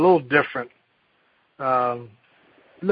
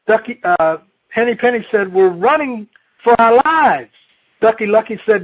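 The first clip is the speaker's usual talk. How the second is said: put-on lively read-aloud to children; storyteller reading a picture book; story reading; 6 semitones higher, 4 dB louder than usual. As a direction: tell the fable with bright animal voices. Reads Chicken Little aloud.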